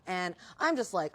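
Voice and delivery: ordinary talk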